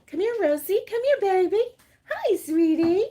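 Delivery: high, loud cooing to the dog; sweet pet-talk voice. Real, not acted. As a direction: coo at a beloved pet. Baby-talking to her dog.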